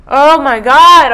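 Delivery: loud, slow exclamation, pitched high; overwhelmed outburst. Real, not acted. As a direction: let it burst out loud and drawn out. Overwhelmed as the chapter ends.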